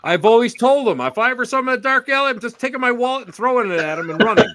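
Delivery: high, loud, rushed comic alarm; mock panic. Joking about meeting him in a dark alley, mock panic. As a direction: blurt it fast and high, playing terrified for laughs. Joking he would flee from friend.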